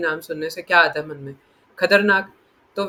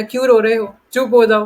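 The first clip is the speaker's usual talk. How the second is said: loud mock-scolding of a crying child; scolding mother; 4 semitones higher, 8 dB louder than usual. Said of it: "तो यशोदा मैया ऐसे बोलती होंगी".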